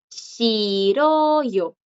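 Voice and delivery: Shiroyo stretched out syllable by syllable; drawn-out Shi-ro-yo, rising on ro and falling away; silent J. Shown as a demonstration. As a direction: model Shiroyo slowly, no h before the vowel. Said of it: "Igual la J no suena".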